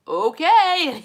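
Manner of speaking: high, loud imitation of a bemused husband; mock okay-then. Husband backing away from her.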